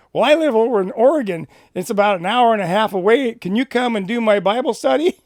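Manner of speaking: excitedly asking a favour, voice lifted; cowboy inviting him to lead Bible study, eager and loud; eager cowboy request. Retold or imitated.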